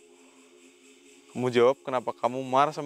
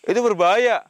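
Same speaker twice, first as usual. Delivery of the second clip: raised, higher warning cry; loud alarmed warning; 6 semitones higher, 6 dB louder than usual. Real, not acted. Ghost hunter warning the spirit's machete is dangerous, loud alarm. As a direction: warn them sharply of the danger.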